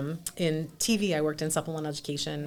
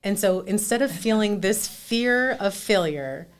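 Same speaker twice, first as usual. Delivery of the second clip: ordinary delivery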